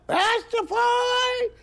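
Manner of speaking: very high, drawn-out falsetto crow; slow piercing cock-a-doodle delivery; rooster crow. Retold or imitated. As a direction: crow the word like a cockerel. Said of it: "says my cock".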